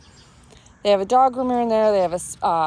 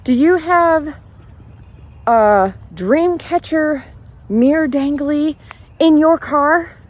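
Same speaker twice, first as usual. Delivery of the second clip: exasperated raised questioning; 5 semitones higher, 5 dB louder, slower than usual. Retold or imitated. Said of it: "I was like, very".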